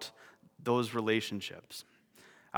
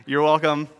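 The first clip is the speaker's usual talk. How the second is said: playful aside; 8 dB louder than usual. Real, not acted. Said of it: "chance for me to get extra nerdy".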